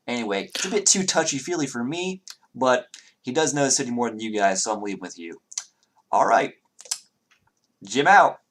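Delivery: lightly voiced departing character, pitch lifted; Jim, breezily dismissive. Jim begs off to leave with the party, lightly voiced. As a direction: toss off a showy sign-off in a light character voice.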